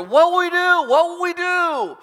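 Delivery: high, loud wail of despair; panicked cry. Acted. Terrified servant cries out to the prophet, panicked. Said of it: "this servant cries out to Elijah".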